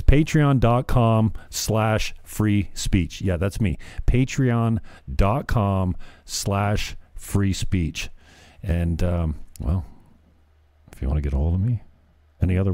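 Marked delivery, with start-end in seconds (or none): 0.0-3.2 slow dictation. Acted. Spelling out web address slowly. 4.1-8.1 very slow, every word spelled out; slow dictation. Acted. Host repeating his Patreon address to listeners, very slowly.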